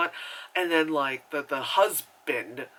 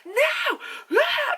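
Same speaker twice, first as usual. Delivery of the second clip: high, loud pretend shriek; hammed-up frantic scream; mock freak-out; 4 dB louder than usual. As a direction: yelp as if losing it. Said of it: "just starts freaking out".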